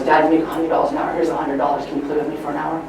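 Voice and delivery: soft, low, tender plea; timid son. Acted. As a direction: ask dad gently, quietly. Son shyly asks for father's time.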